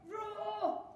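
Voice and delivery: high-pitched pretend bear roar; playful roar. A narrator's reading. Friends playing bears on the bridge, high roar.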